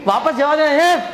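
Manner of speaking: ordinary delivery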